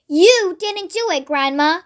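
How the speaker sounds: usual speaking voice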